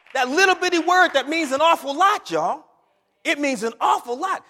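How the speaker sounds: loud, high preaching climax; full-force preaching. Acted. Pastor driving home the word if to the congregation, loud preaching. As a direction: preach it out to the back pews.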